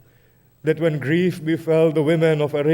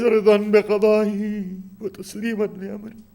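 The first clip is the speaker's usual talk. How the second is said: higher, rapid Arabic chant of surrender; dying words in Arabic; 7 semitones higher, much faster than usual. Acted. Hussein's final words to God as quick high Arabic prayer. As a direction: murmur the last prayer fast and high.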